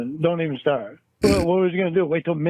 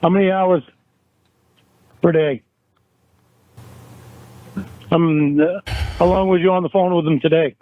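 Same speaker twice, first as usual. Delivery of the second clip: louder, hammering question; heated interrogation; 5 dB louder than usual. Acted. Boyfriend interrogating Jamie about call hours, angry and raised. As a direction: grill her, voice raised. In-character quarrel over texts.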